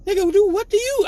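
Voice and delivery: high, loud, disbelieving dad; incredulous dad. Retold or imitated. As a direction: fire it back at the kid in disbelief. Dad snapping at son.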